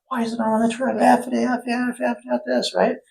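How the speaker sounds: very high, hammed-up swearing fit; mock tantrum. Cousin ranting at the plumber over the truck, high mock tantrum. Retold boss blowing up.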